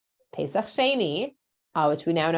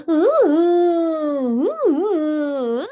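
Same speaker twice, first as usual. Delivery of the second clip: high, loud whiny moaning; mock whining; 8 semitones higher, 7 dB louder, much slower than usual. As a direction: moan like a spoiled crowd complaining. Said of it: "the sound of their whining".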